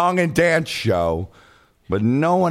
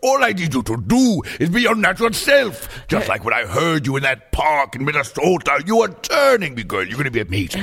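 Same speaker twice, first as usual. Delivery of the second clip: lightly voiced sassy diva, delighted with nature; a hint of a camp diva loving the outdoors. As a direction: purr it like a fierce diva.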